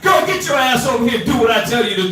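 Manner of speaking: high, loud mock shout at his wife; mock husband yell. Husband yelling at his wife to come over, loud mock shout. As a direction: bark it like a bossy husband. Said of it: "him get a little loud voice".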